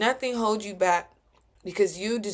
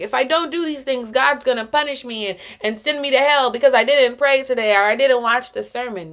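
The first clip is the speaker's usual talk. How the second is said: higher, louder mock-pious worrier; mock Bible thumper; 6 semitones higher, 8 dB louder than usual. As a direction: fret loudly like a punishment-fearing believer. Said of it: "this is not a Bible thumper".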